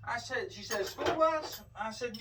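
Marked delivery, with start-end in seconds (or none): 0.9-1.6 high, puzzled clerk voice, lightly put on; a touch of the clerk's surprise, pitched up; imitating the clerk. Narrator mimics the county clerk asking about the bus, high and puzzled.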